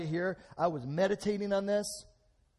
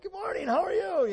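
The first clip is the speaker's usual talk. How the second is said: high, bright-voiced mock greeting, much higher; cheery greeting; 5 dB louder than usual. Preacher greeting people at school, high and cheery. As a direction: sing out a cheerful good morning. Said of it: "I'm like".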